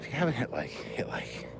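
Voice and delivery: soft whispered request; whispering. Real, not acted. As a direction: whisper the plea to viewers. Asking for likes.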